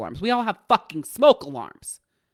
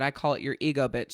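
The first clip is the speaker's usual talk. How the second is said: normal talking voice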